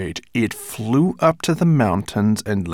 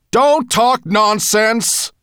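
slow, high, loud furious roar; 10 dB louder, much slower than usual. Acted. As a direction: roar it out in fury. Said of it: "came Nettlebrand's furious voice".

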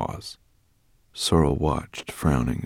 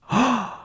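sharp gasp; 3 dB louder than usual. Acted. Sudden gasp.

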